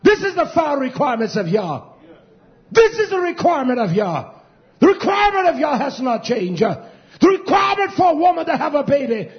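high, loud sermon shout; fiery pulpit cry, stretched key words; full-force preaching. Real, not acted. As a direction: drive every phrase home at a shout.

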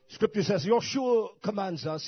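loud, high scripture proclamation; ringing pulpit announcement. Real, not acted. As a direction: declare it forcefully to the congregation. Preaching at full force.

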